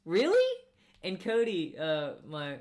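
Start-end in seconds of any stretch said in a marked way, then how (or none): 0.0-0.6 sharp high incredulous "Really?". Retold or imitated. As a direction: fire it back, loud and disbelieving. Retold sarcastic retort to customer.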